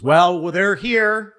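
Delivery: a lightly voiced showman teasing the surprise; Howie Mandel. Game show host milking the reveal to the Marine, slow and high. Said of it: "Howie Mandel goes".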